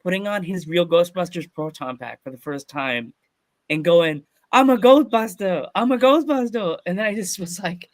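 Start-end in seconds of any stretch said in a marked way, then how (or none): 4.5-6.8 put-on high, loud excited kid voice; nine-year-old boy. Little boy first wearing his proton pack, thrilled high shout. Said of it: "the nine-year-old me".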